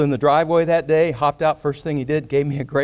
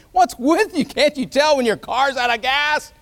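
high, loud, scornful scolding; hammed-up humiliating outburst; shaming; 12 semitones higher, 4 dB louder than usual. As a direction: berate him as if he were an idiot. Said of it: "he could have shamed me".

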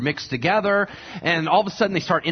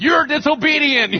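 indignant scolding; 9 semitones higher, 5 dB louder than usual. Retold or imitated. Balaam argues with the donkey.

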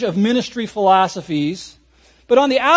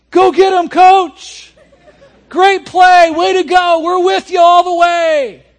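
high, loud cheering; cheering players; 8 semitones higher, 8 dB louder than usual. Retold or imitated. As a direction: whoop the coach on from the bench. Bench players cheering the coach.

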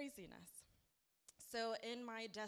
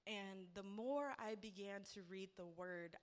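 ordinary talk, nothing marked

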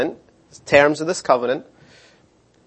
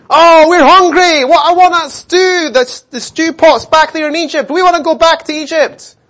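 whining Israelites; 11 semitones higher, 6 dB louder than usual. Retold or imitated. Preacher mocks the grumbling Israelites with a whine. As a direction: whine like a spoilt crowd begging for stew. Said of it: "And then they're like".

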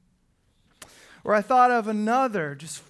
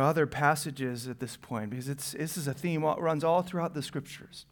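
usual speaking voice

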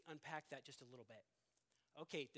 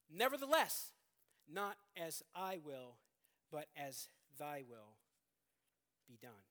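surrender; 4 semitones lower, 6 dB louder, slower than usual. Acted. Jesus yielding to the Father, from loud to hushed. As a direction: give in slowly, letting the voice sink to a hush.

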